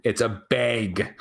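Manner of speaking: "bag" drawn out with a hard Wisconsin A. Shown as a demonstration. Showing a co-host how Wisconsin says bag, slow Wisconsin A. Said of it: "how they would pronounce it".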